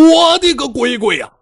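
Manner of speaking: high, loud startled cry; panicked wail. A narrator's reading. Bai Jianfei seeing fairy Mengluo, high panicked wail. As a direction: yelp in horror at the sight.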